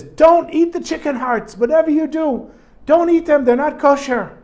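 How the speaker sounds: a butcher bursting in to stop everyone eating; alarmed shout. Retold or imitated. Butcher comes running in warning.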